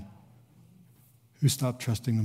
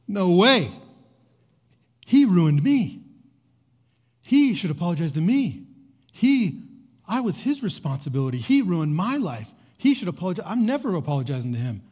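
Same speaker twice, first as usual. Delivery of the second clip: louder, higher indignant protest; Johnny's angry refusal; 6 semitones higher, 4 dB louder than usual. Retold or imitated. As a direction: voice the young man flatly refusing, hurt and angry.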